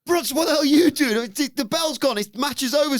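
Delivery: very high, loud mock yell; mock Pearce yelling. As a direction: shout it high like a frantic referee. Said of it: "Runs in to be like".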